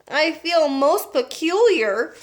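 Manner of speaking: slow, breathless; gasping. A narrator's reading. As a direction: gasp the words out. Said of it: "gasped Violet".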